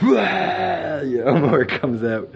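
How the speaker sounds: laughing through the words; a real laugh breaks in while purging. Real, not acted. Telling friends about the purge, laughing.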